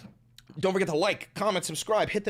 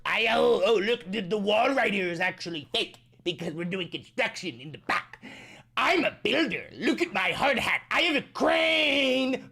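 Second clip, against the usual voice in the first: mocking Trump impression; 4 semitones higher than usual. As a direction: ham up a boastful old man. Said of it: "He's like".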